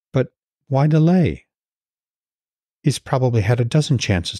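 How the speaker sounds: even audiobook narration with lightly voiced characters; story narration. A narrator's reading. Podcast host reading his Norse novel aloud, steady narration. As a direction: read the chapter like a storyteller.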